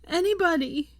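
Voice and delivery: high, carrying cry; panicked shout; desperate call for help. A narrator's reading. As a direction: call out to anyone who might hear. Screaming up a fissure for help.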